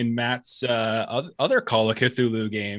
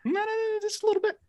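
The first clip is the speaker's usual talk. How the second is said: very high, tiny playful voice; teasing squeak; faster than usual. Pretend. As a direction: squeak it like a harmless little plea. Teasing about player versus player.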